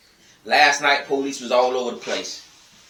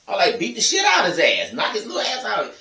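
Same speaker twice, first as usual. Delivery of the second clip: angry outburst; 7 semitones higher than usual. Real, not acted. Angry advice about disobedient kid.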